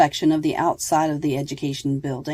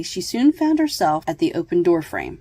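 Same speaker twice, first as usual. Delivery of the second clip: plain reading voice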